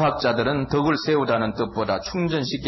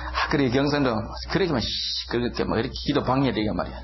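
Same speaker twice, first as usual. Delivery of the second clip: ordinary talk, nothing marked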